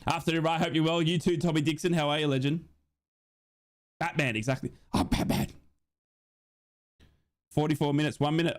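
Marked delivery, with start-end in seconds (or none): none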